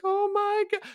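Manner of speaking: overjoyed, very high weepy squeal; weepy high pitch. Acted. Tiefling overwhelmed by a creature's beauty, weepy high pitch. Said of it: "weepy high pitch".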